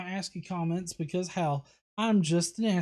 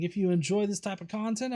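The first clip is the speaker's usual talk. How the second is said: usual speaking voice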